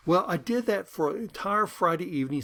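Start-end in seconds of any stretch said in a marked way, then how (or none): none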